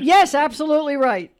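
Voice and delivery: high, loud burst of approval; excited confirmation. Real, not acted. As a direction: celebrate the winning answer out loud. Confirming the winning quiz answer.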